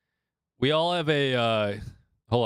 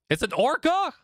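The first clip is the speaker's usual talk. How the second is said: surprised question; 10 semitones higher than usual. Real, not acted. Asking back in surprise.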